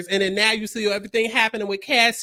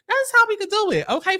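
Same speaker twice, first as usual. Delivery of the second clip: excited; 10 semitones higher than usual. Real, not acted. Proposing harsher punishment excitedly.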